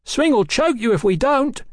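high, frantic rant; shouting. A narrator's reading. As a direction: ruffian goading his gang at a high pitch. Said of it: "shouted the muffled man".

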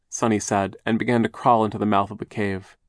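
ordinary delivery

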